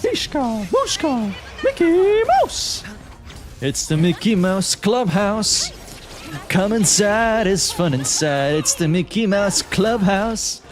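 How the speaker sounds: squeaky falsetto Mickey Mouse, slow and sing-song; Mickey Mouse impression. Answering a chat question, doing Mickey Mouse in a squeaky falsetto. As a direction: chirp it out high like the cartoon mouse. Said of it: "do these voices so well".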